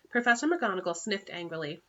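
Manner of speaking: plain reading voice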